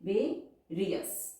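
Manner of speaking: Various stretched very slowly, each vowel sounded; slow, vowels split apart. Shown as a demonstration. Teacher showing the diphone in various, very slow. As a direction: draw various out for the class. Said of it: "यहाँ पे डायफोन sound है".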